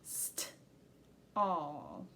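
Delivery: St-all drawn out low; segmented sounding out. Shown as a demonstration. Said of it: "this all sound".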